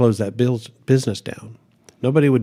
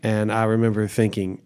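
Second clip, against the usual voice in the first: ordinary talk, nothing marked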